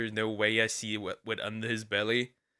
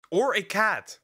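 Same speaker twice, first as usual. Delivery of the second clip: higher-pitched, emphatic disbelief; incredulous question; 7 semitones higher than usual. Real, not acted. Streamer appalled it could be a cat, incredulous question.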